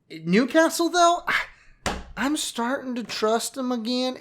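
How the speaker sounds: half-unintended Southern accent, talking to Newcastle. Real, not acted. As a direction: slip into a folksy Southern accent. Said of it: "everything's going to a southern accent".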